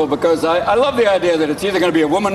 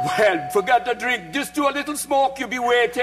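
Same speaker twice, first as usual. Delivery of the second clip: put-on Jamaican accent; 5 semitones higher than usual.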